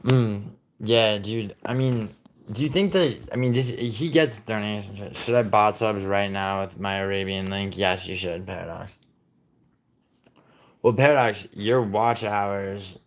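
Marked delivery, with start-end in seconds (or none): none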